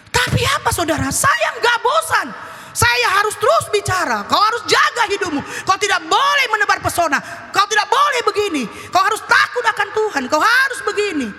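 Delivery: high, emphatic string of commands; forceful preaching. Acted. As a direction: lean hard on each key word. Preaches unwearied commands to children. (khotbah tegas penuh perintah)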